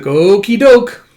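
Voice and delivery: high, loud, drawn-out agreement; cheerful little girl. Acted. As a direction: sing out a childlike okay. Anya voiced agreeing.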